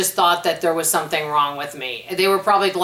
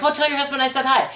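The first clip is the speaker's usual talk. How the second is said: high, quick, bubbly bank teller greeting; chatty bank lady; 7 semitones higher, faster than usual. Retold or imitated. Bank lady sends cheerful hello to husband, high and quick. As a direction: gush it out fast like a friendly teller. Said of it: "They're like".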